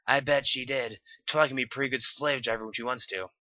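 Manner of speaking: lightly voiced Applejack; Applejack's voice. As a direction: tease in jest. Applejack joking.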